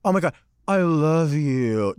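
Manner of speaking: slow, lightly voiced gushing fan. Stranger fawning over queen's makeup, slow mock gush. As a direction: drawl it like a starstruck stranger. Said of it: "walk up to somebody and go".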